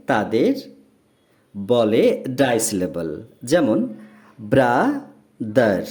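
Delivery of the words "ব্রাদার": ব্রাদার very slowly, in two separate parts; long "bra", a pause, then "ther" in ব্রাদার; broken into syllables. Shown as a demonstration. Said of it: "word দুবার ভেঙে উচ্চারণ".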